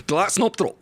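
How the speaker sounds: light mock Scottish accent. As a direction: tease a Scottish friend in a hint of Scottish accent. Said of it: "I like to say this to my Scottish friends".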